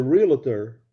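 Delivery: realtor said as REAL-uh-ter; imitating the wrong realtor, "ree-luh-ter"; mispronounced realtor. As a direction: slip an extra syllable into realtor. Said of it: "he kept saying".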